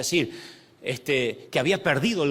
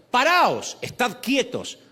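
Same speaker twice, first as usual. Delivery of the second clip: raised, high command to halt; orden profética; 8 semitones higher, 3 dB louder, slower than usual. Acted. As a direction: call out the prophet's order, first word loudest. El profeta da órdenes al ejército. (orden enérgica de profeta)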